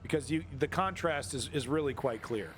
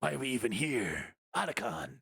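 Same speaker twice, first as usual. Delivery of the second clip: slow, lower brooding game hero; Metal Gear Solid rant; 4 semitones lower than usual. Retold or imitated. Streamer parodies a Metal Gear Solid hero talking to Otacon, slow and brooding. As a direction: brood like a weary soldier calling his friend. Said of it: "Get a Metal Gear Solid rant going".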